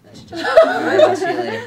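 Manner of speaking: high laughing goodbye. Real, not acted. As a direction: laugh through it, squeaky high.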